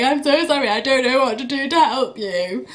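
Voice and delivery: put-on pleading with a crying baby; helpless plea. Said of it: "me being like".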